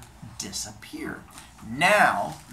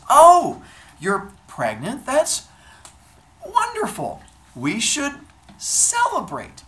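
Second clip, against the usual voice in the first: loud, high, stretched-out mock joy; feigned delight; 14 semitones higher, 11 dB louder, slower than usual. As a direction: gush with drawn-out, forced enthusiasm. God feigns delight at pregnancy news.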